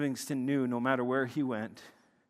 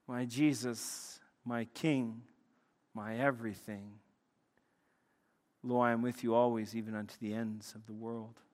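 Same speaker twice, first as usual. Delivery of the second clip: soft, low prayerful recital; reverent prayer; 5 semitones lower, 8 dB quieter than usual. Acted. Preacher reciting Livingston's prayer to close his point, reverent. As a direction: let the prayer settle quietly, pausing between phrases.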